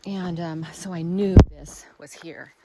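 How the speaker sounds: ordinary talk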